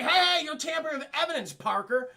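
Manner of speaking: high, loud mock scolding; scolding detective. Detective scolding Peter for tampering, loud and high. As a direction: snap at him for touching evidence.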